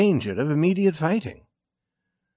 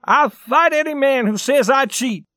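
high, loud challenge; defiant cry; 12 semitones higher, 5 dB louder than usual. A narrator's reading. Accused player offering to fight, defiant cry. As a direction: throw down a high-pitched angry challenge. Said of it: "cried Johnny".

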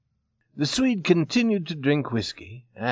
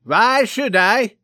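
loud, high, drawn-out challenge; blustering Swede shouting back; demanding; 9 semitones higher, 8 dB louder, slower than usual. A narrator's reading. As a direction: demand it defiantly at full voice. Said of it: "demanded the Swede".

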